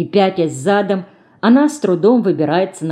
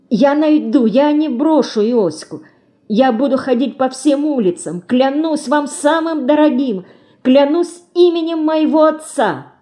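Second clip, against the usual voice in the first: high, pleading promise through tears; tearful vow; 7 semitones higher than usual. A narrator's reading. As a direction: swear it desperately, pitch climbing. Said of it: "голос её прерывается слезами". (умоляющая клятва сквозь слёзы)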